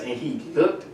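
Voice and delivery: usual speaking voice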